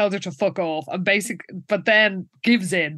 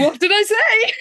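high, louder imitation of gleeful triumph; retold delight; 12 semitones higher, 5 dB louder than usual. Jessica is delighted all got detention, gleeful. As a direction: crow it in mock delight. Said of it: "Jessica is delighted and says".

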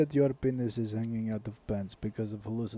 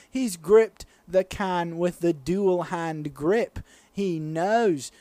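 slow, higher, drawn-out British play-by-play; British sports commentator; 5 semitones higher, slower than usual. Acted. Commentating Marco's chug as sport, in a British accent. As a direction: build suspense like an Olympic commentator. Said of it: "I'll commentate the whole bit".

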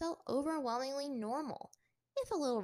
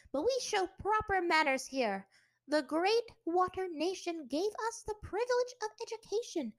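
scolding teacher; 9 semitones higher, 5 dB louder than usual. Acted. Teacher scolds rude new pupil.

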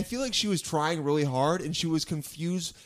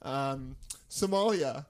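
fake Somali accent; 2 semitones lower, 5 dB quieter, much slower than usual. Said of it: "how bad is my accent?".

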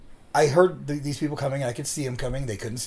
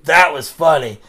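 loud, imitated amused dad; retold line bursting with laughter; laughing dad; 8 dB louder, slower than usual. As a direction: say it loudly through laughter, as the tickled dad. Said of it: "he was laughing so hard".

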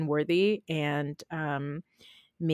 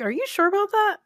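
incredulous high voice; 4 dB louder, faster than usual. Retold or imitated. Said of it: "I'm like".

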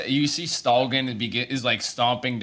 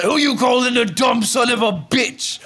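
loud, much higher furious yell; angry shout; 10 semitones higher, 4 dB louder than usual. Acted. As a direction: bellow it chasing him. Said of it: "He's like".

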